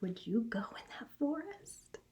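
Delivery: whispered question to the class; hushed spooky question. Real, not acted. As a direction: lean in and whisper it. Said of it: "whispered question to class".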